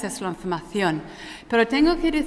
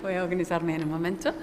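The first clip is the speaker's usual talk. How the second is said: fast, soft, laughing through words; 4 semitones lower, 5 dB quieter, much faster than usual. Real, not acted. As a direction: chuckle it out quickly. Embarrassed about her jacket inside-out.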